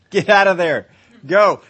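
loud, laughing command to flee; preacher shouting at the congregation to get out; urgent shout. Real, not acted. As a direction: yell it through a laugh, pushing them away from danger. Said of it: "run as fast as you can".

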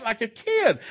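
ordinary talk

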